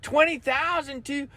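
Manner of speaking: high, panicky mock trader voice; panicked trader. Follower panicking to Leo about his account, high and frantic. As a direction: play a frantic follower losing money. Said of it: "people that are just screaming".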